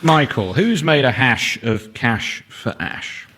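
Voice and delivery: normal talking voice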